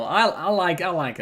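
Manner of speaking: ordinary talk, nothing marked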